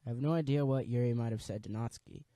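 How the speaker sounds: usual speaking voice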